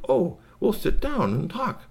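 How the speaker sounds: high, slow alien voice; welcoming alien. Acted.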